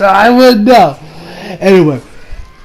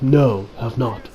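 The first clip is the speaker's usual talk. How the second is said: low terse voice; 9 semitones lower, 9 dB quieter, slower than usual. Retold or imitated. Terse reply voiced in recap.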